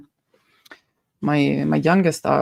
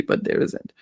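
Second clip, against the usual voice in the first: whispered aside; 4 dB quieter than usual. Real, not acted. Hushed aside.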